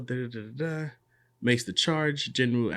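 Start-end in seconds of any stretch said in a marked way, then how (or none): none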